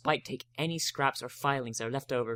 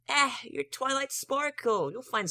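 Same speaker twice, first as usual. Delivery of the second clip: Spike voice; 11 semitones higher, slower than usual. A narrator's reading. Spike voiced, high and slow.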